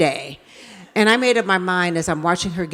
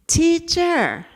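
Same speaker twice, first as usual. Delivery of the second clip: put-on needy child; 9 semitones higher, 4 dB louder than usual. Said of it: "I couldn't stand this".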